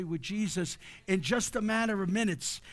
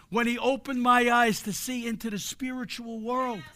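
ordinary talk, nothing marked

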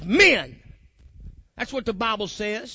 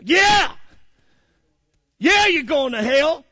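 loud, high exclaimed yes, then slower; emphatic retort; 3 semitones higher, 6 dB louder, slower than usual. Acted. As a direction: fire back a blunt answer. Blunt answer to acted doubter.

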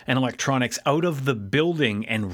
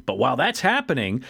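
normal talking voice

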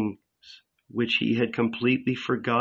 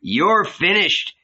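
forceful, raised threatening voice; stern apostle; 6 semitones higher than usual. Acted. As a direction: warn him off, hard and high.

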